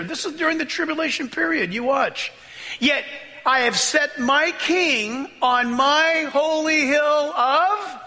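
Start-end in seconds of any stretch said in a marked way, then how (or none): 2.8-8.0 slow, raised, ringing proclamation; fervent scripture reading; preaching scripture. Acted. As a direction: declare it from the pulpit. Preaching scripture at full force.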